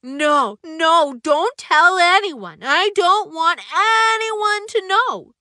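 a shy painter loudly begging his friend to keep a secret; pleading Misty. Acted. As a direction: beg him not to tell. Misty begging him to keep quiet.

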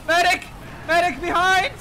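loud high call for a medic; man down shout. Real, not acted. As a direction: shout for help across the field. Calling medic in battle.

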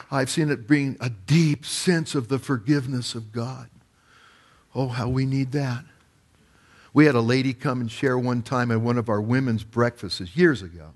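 ordinary talk

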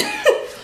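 high, disbelieving burst of laughter; incredulous laugh. Real, not acted. Laughing in high disbelief at the boss's question.

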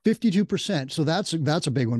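usual speaking voice

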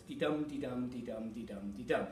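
steady di-dum di-dum, stress on each dum; iambic rhythm. Shown as a demonstration. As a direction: tap out the beat, di-dum. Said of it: "So it's five beats".

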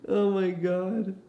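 breaking into a laugh, then a slow laughing oh my God. Real, not acted. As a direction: crack up at the dog mid-sentence. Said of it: "I'm laughing 'cause of Duke right now".

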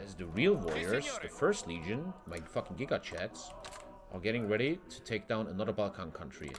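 ordinary delivery

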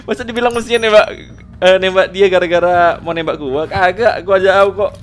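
very loud, high-pitched denial; loud, high, worked-up banter; indignant protest. Real, not acted. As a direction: protest your innocence at full volume. Protests innocence, laughing off accusation.

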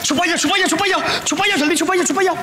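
rapid-fire repeated urging; urgent hurrying. Acted. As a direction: hurry everyone into hiding. Hurrying others into hiding.

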